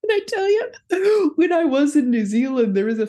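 high, laughing storytelling; real laughter. Real, not acted. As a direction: start the story while still cracking up. Still laughing after the joke.

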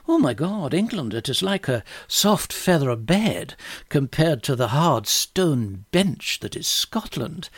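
hammed-up Scottish lilt, pitch swooping; badly faked Scots, savouring the words; terrible Scottish accent. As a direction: play a Scottish king marvelling, in a bad accent. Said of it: "excuse my terrible accent".

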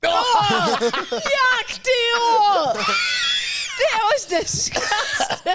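high, loud laughing disgust. Real, not acted. Reacting to a kissing sound.

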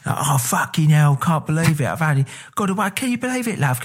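put-on high, overdone Cockney accent. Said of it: "way too much into, like, Cockney".

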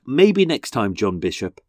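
usual speaking voice

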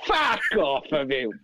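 drawn-out, disbelieving brush-off; retold astonishment, long falling words; incredulous exclamation. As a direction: stretch each word in mock disbelief. Retold disbelief at book remark.

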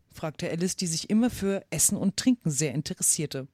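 usual speaking voice

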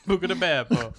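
laughing fake Italian accent.

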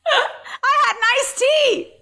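very high, drawn-out comic whine; hammed-up squeal of injustice; mock wailing protest. Declined-card bit, laughing protest.

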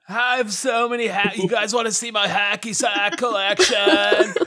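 stoner. Pretend. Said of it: "nineties television high".

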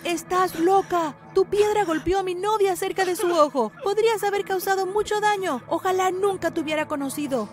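put-on angry boyfriend shouting from the window. A narrator's reading. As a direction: yell at her to leave. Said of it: "gritó".

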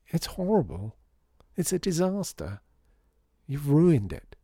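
high, pained complaint with pauses between blows; dismayed child. A narrator's reading. Lydia seeing the ruined Christmas display, dismayed. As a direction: voice lifts in horrified protest.

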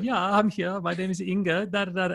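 put-on Swedish accent. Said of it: "put on, like, a Swedish accent".

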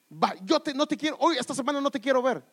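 loud, high mock tantrum; imitated child yelling at a parent; shouting child. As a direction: fire it off like a furious brat. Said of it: "le grita, le levanta la voz".

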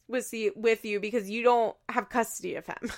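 ordinary talk, nothing marked

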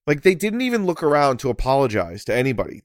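ordinary talk, nothing marked

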